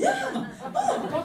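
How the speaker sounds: high, laughing exclamations; mock excitement. Awakened skin cells greeting the cream, mock excited. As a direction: burst out high like startled, delighted cells.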